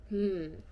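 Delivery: thoughtful hmm. Acted. Eddie pondering the choice.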